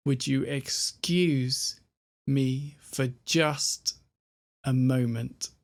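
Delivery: slow, leaning on "excuse", "just" and "moment"; stressed words marked. Shown as a demonstration. Teacher showing which words the speaker stresses, slowly. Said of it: "the words he stresses are".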